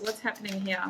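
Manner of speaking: ordinary delivery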